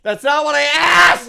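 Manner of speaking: shouting back at Alexa after a wrong answer; frustrated shout. Real, not acted. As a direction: yell at the smart speaker that got it wrong. Shouting at the voice assistant.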